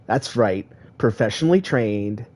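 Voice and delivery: usual speaking voice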